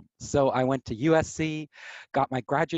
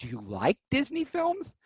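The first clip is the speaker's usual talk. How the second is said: mock disbelief, much higher; lightly voiced incredulous interviewer; 10 semitones higher than usual. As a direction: imitate the baffled interviewer.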